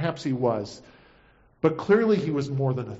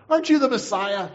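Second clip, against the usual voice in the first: lightly raised, higher taunt; mild narrated yell, pitched up; yelled insult; 8 semitones higher, 4 dB louder than usual. A narrator's reading. Criminal hanging there to the Messiah, mildly yelled insult. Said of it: "began to yell insults at him".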